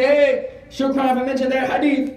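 loud, high-pitched gushing gratitude; enthusiastic thanks. Acted. Brother thanking him after Jumu'ah for a hadith, enthusiastic.

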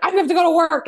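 high, loud mock exasperation; frustrated outburst. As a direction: blurt it out like a fed-up mom. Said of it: "we all do when we get frustrated".

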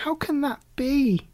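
high kitten disbelief; can't believe it; dismayed kitten. A narrator's reading. Kitten asking about missing popsicles, disbelieving. Alley Cat voiced.

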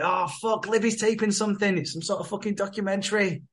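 high-pitched mock dismay; acted-out flustered apology; dismayed excuse. Drew's acted excuse at his house.

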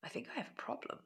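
hushed, voiceless admission; whispered confession of a problem. Retold or imitated. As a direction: breathe it out like a secret confession. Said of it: "going into the bedroom and saying".

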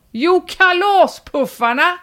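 drawn-out, high-pitched call; mock shout. Poster repeats himself to puzzled neighbour at car, mock shout. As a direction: stretch the word slowly in a high mock yell. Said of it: "öppnade jag fönstret och skrek".

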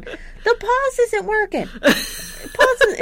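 high, slow mock yell; yelling dad. Deaf dad over a blasting TV, mock yelling. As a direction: holler it high and drawn out. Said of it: "so he's yelling".

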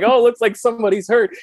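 high, eager kid playing pretend; a child announcing an emergency; playful child voice. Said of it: "and they'd be like".

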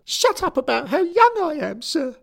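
a furious choirboy insisting he's grown; angry schoolboy. A narrator's reading. Derek protesting to his boss, high indignant boy.

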